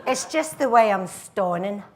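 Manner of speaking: broad Glaswegian comic comeback; cocky Glaswegian girl at a dance; gallus Glaswegian accent. Acted. Girl puts off guy in dance hall, gallus Glaswegian accent. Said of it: "I go into the accent".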